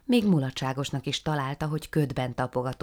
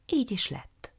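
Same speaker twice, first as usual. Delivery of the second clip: ordinary delivery, nothing marked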